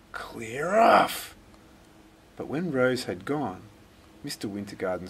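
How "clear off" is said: loud whispered snap; harsh hiss. Acted. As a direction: spit it out to drive her off. Grump chases the girl away.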